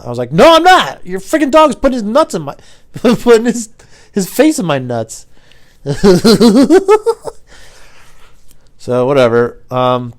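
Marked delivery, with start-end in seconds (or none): 0.3-5.3 high, loud mock yelling; outraged protest. Driver yelling at the dog owner, high loud protest. 5.3-8.7 high, loud burst of laughter; laughing. Real, not acted. Comic cracking up at his own dog story, real laughter.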